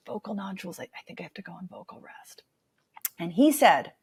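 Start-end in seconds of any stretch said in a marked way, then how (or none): 0.1-2.4 painful, breathy near-whisper, hardly any sound; barely audible voice. Retold or imitated. To her acting teacher in class, a pained, barely audible whisper. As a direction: force out each word as if it hurts. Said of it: "extremely painful, barely audible voice".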